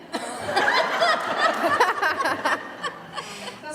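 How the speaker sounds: high, long laugh; big real laugh after the punchline; burst of laughter. Real, not acted. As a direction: laugh hard at the joke.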